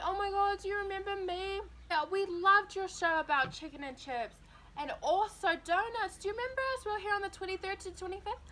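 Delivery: high, gushing mock-fan voice; gushing family. Family greeting exhibition staff, high gushing mock voice. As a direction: squeal it like an overeager fan.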